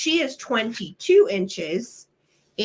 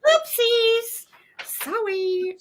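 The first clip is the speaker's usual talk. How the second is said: high-pitched apology; 13 semitones higher, much slower than usual. Real, not acted. Embarrassed apology, laughing.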